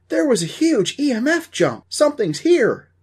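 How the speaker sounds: high, loud burst of alarm; excited ghost hunter. Acted. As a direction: call out an exciting discovery at full pitch. Dusty excitedly reports a reading.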